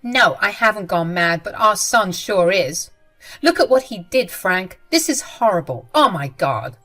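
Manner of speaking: wife ranting to her husband, loud and upset; angry rant. Acted. Jenny rants to husband.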